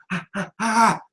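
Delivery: ordinary talk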